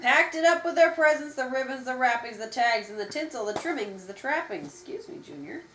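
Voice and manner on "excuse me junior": hushed parent aside. Real, not acted. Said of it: "aside to child".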